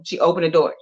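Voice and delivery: ordinary delivery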